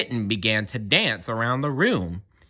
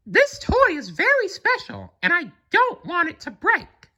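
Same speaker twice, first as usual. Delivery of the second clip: very high falsetto warning; frowning Mickey. A narrator's reading. Mickey warning Molly about the toy, high and stern. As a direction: warn the cat like Mickey.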